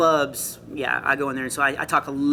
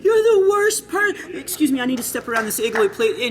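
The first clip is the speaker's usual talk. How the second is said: mock storming-out girl; 12 semitones higher than usual. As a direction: ham up a sobbing girl shoving through a crowd. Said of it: "storm out, she was like".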